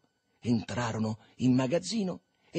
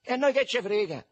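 high, loud defiant comeback; cheeky high-pitched shout; shouted retort; 11 semitones higher, 7 dB louder than usual. A narrator's reading. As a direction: yell it back at the soldier. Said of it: "gridò Marcello".

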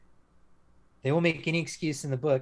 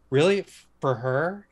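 high, loud "Really?", then a slow, drawn "for her"; a hammed-up retold double take; mock disbelief; 4 semitones higher, 7 dB louder than usual. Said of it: "And I'm like".

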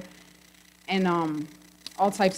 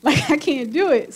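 mock panic with a nervous laugh; panicked prayer; 6 semitones higher, 5 dB louder than usual. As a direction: laugh through a half-mock panic plea. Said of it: "I started having a panic attack".

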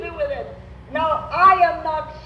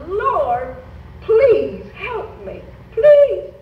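rising desperate plea to the Lord; pleading prayer; 5 semitones higher, 6 dB louder, much slower than usual. Retold or imitated. Preacher retelling his prayer, a pleading cry. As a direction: beg God, building to a cry. Retold prayer crying to God.

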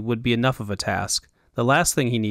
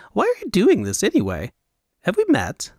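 high Elvunian fop; 8 semitones higher than usual. Acted. Fop figure voiced.

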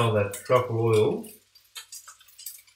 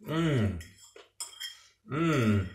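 short falling hums of enjoyment; two satisfied "mmm"s over food; savouring hum; 5 dB quieter than usual. Real, not acted. Savouring prawn and pasta.

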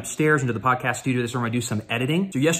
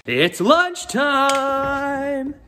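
sing-song announcement; 13 semitones higher, 3 dB louder, much slower than usual. Real, not acted. Announcing lunch.

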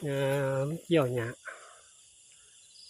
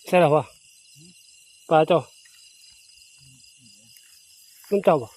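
ตะโกนถาม; 8 dB louder than usual. Real, not acted. Calling out to someone nearby.

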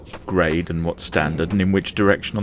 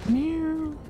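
cat meow; 4 dB quieter than usual. Pretend. Cat noise in game chat.

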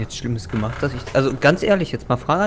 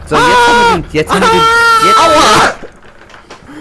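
much higher, loud excited shout; 10 dB louder, slower than usual. Real, not acted. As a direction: yell it out. Excited outburst.

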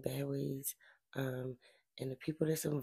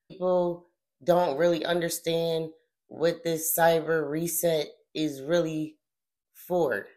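slow, deliberate, forceful phrasing; careful wording; 2 semitones higher, 7 dB louder, slower than usual. Real, not acted. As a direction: weigh each phrase and press it home. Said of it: "let me be careful how I'ma word this".

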